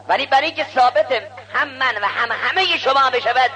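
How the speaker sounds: high, loud declamation; public challenge. Acted. Scholar addressing Ali before the assembly, public challenge. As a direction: proclaim it to a whole assembly.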